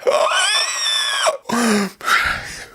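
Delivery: long, very high strangled gasp; mock agonized gasp. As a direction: suck air in a piercing, hammed-up wheeze. Coworker reacts to hot pepper.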